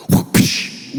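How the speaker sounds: whooshing whip-crack noise, no voice; sharp mouth-made whip sound; imitated whip crack. Shown as a demonstration. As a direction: make the swish and crack of a whip.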